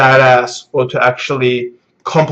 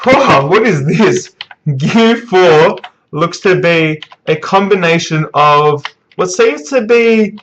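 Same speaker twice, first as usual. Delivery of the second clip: hyped astonishment; 5 semitones higher than usual. Real, not acted. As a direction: savour each word of a startling discovery. Reacting to new power reveal.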